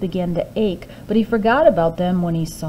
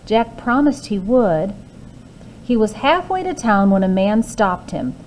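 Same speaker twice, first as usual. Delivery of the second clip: ordinary delivery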